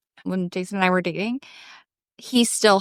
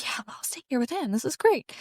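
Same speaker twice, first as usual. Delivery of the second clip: whispered aside; 4 semitones higher, 7 dB quieter than usual. Retold or imitated. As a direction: whisper it like a secret plan. Mom quoted volunteering to babysit.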